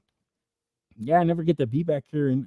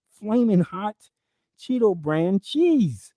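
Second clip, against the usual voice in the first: mock preaching rant; 4 semitones higher than usual. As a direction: preach it like an apocalyptic sermon. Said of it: "I start speaking in tongues".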